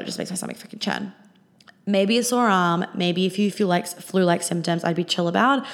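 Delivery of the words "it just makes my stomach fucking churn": low unvoiced whisper; whispered disgust. Real, not acted. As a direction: breathe it out in disgust. Disgust muttered under breath.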